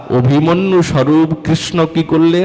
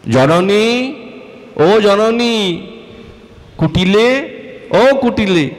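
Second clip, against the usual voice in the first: calling out; 6 semitones higher, slower than usual. Acted. Calling out to mother and Kutila.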